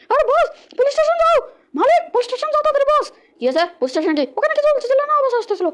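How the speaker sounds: panicked high henchman. Acted. Henchman urges the boss to the police station, squeaky and hurried. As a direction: rush the boss along.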